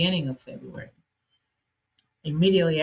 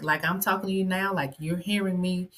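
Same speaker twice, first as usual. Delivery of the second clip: ordinary talk, nothing marked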